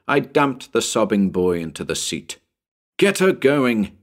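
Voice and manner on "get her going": higher, louder urgent call; yelled. A narrator's reading. Narrator yelling to Bill from the running board, urgent call. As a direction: yell it over the noise. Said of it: "I yelled to Bill".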